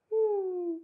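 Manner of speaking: gemido agudo de cachorrinho chorando; nasal hum demo. Shown as a demonstration. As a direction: hum anasalado e agudo, como filhote choramingando. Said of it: "que a gente falou do cachorrinho chorando". (demonstração de hum nasal agudo)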